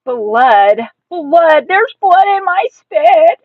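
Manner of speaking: loud cries climbing high; frantic child's alarm; horrified hollering. A narrator's reading. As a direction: holler it in panic, stretching the words. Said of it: "I hollered some more".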